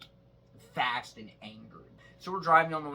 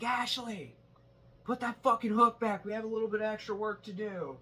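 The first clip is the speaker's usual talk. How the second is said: imitating angry boss; 8 semitones higher than usual. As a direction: play the boss snapping orders at her. Boss yelling at her, retold.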